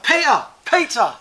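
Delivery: loud, high call across a room; retold shout. Calling to Peter across the crowd, shouting. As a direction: shout the name to get attention. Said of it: "So I've shouted".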